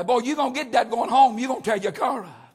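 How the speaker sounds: ordinary talk, nothing marked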